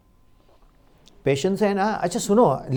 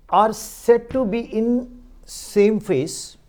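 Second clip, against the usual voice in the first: slow dictation; much slower than usual. Acted.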